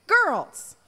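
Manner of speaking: high, loud call from the steps. Acted. Rabbit calling out to the girls on the porch. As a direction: sing out to get their attention.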